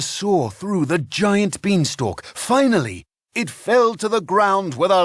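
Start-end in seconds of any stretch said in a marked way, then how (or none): none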